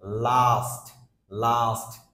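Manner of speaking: লাস্ট with a held aa; long aa in last. Shown as a demonstration. Teacher modelling লাস্ট for learners. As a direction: draw out the aa in লাস্ট. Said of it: "the second one is".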